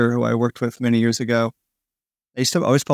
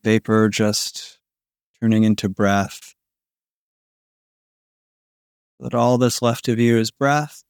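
sleep story narrator; 2 semitones lower than usual. Acted. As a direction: lull them toward sleep, breath by breath. Guided body-melting sleep relaxation.

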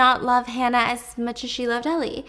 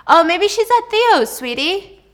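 husband voice; 9 semitones higher, 7 dB louder, slower than usual. Retold or imitated. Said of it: "he says".

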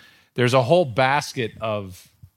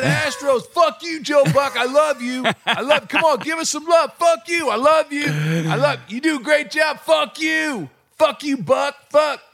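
heckler meltdown; 14 semitones higher than usual. Retold or imitated. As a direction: swing between cursing and loving, all at full volume. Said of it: "he had a complete meltdown".

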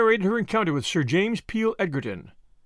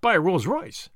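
astonished cry; 5 semitones higher than usual. A narrator's reading. Tuppence objecting to Julius buying a Rolls Royce, astonished protest. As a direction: blurt it out in disbelief, voice shooting up. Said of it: "cried Tuppence".

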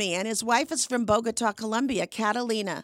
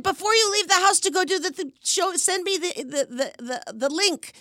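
put-on higher-pitched, lightly Spanish-accented relative; imitated Spanish accent; 8 semitones higher, 5 dB louder than usual. Guest imitating her nephew's wife asking for the link, light Spanish accent. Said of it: "in her darling Spanish accent".

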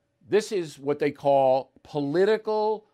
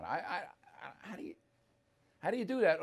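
ordinary talk, nothing marked

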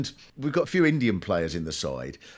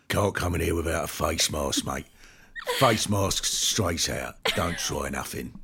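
low, gruff bouncer at the door, lightly voiced; 10 semitones lower than usual. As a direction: play a no-nonsense doorman.

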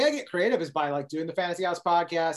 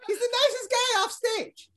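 very high, slow put-on voice; impressionist's high, slow mock-sweet line; Chris Neff impression. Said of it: "I'd be like".

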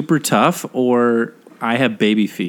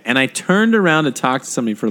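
normal talking voice

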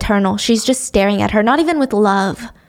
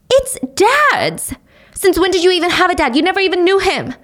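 higher and fast, snapping back; sharp, angry retort; 8 semitones higher than usual. Acted. Mom snapping at Sonny about her dad, higher and fast. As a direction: bite back at her daughter.

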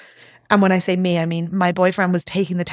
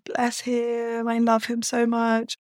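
soft, higher, gentle; tender aside; 5 semitones higher, 4 dB quieter, slower than usual. Real, not acted. Talking fondly about her caring boyfriend, softly tender. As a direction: melt a little as you speak of him.

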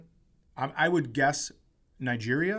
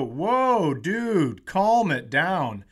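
drawn-out, raised "whoa, dude" to halt someone; retold calming down; 3 semitones higher, 4 dB louder, much slower than usual. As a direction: stretch it out to talk an aggressor down. Retold stepping in to calm aggressor.

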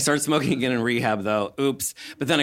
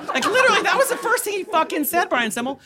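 very high, loud, laughing; laughing disbelief; 3 dB louder than usual. Real, not acted. Comic cracking up at her doctor, laughing. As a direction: say it while cracking up. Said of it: "laughing retelling".

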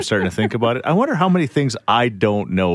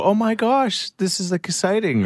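mock excited gush; 11 semitones higher than usual. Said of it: "did she go".

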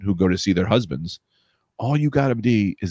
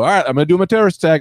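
mock eager recruit; 6 semitones higher, 4 dB louder, faster than usual. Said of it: "and you go".

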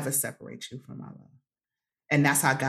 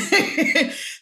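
a burst of airy laughter, pitched up high; high breathy laugh; 13 semitones higher, 3 dB louder than usual. Real, not acted. She laughs at herself while telling friends about her discovery, airy.

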